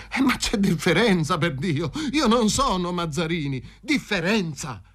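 high-pitched indignant objection; protesting. A narrator's reading. Paroni objecting he is not Mazzarini, protesting. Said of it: "tentò di insorgere".

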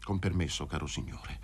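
low, hushed character voice; subdued, fading courtesy; quiet leave-taking. Acted. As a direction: excuse yourself softly and low. Sick man takes his leave.

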